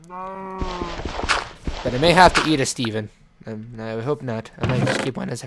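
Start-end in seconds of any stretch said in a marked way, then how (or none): 0.1-3.1 slow, higher mock-menacing drawl; mock sinister. To the sheep, a slow mock-villain threat. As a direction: savour the threat like a cartoon villain. Joking about eating the sheep.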